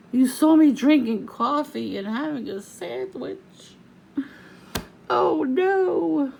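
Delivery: high, loud, slow fake shock; mock amazement. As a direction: ham up fake alarm to ridicule them. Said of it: "I'm making fun of them".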